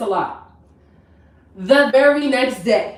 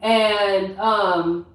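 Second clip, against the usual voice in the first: ordinary delivery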